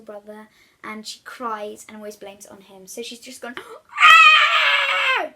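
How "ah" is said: long high wailing cry; a huge bratty wail, held. Acted. Bertha wailing to get her brother blamed, high and loud. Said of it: "she would wail".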